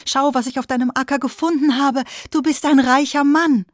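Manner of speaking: high, loud joyful shout; excited call. A narrator's reading. Farmer shouts to his friend about the gold. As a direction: burst out with the good news. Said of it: "rief er".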